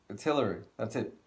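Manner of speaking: ordinary talk, nothing marked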